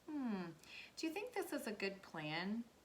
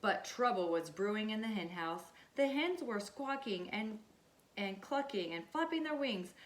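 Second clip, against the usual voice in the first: ordinary delivery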